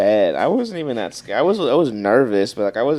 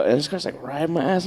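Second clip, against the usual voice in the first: usual speaking voice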